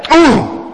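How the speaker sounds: high, groaning "Oh!"; mock a bored schoolgirl's groan; high whiny groan of protest; pupil's groan. Imitating pupil Tina groaning.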